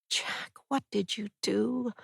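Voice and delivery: breathy whisper, choked after crying; tearful whisper. Acted. Alone in the bathroom, she whispers to Jack through tears.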